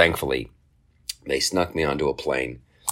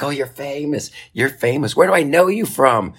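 excited lady; 8 semitones higher than usual. Retold or imitated. Customer service lady recognizing the comedian, high and excited. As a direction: gush with growing excitement. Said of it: "she's like".

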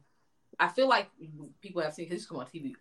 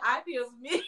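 laughing; 6 semitones higher than usual. Retold or imitated. Repeating the lady's line, laughing.

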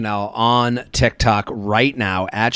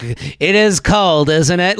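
slow, loud imitation of complaining elders; annoying old complainers; 5 semitones higher, 4 dB louder, much slower than usual. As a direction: drag it out, higher, like a kvetching old-timer.

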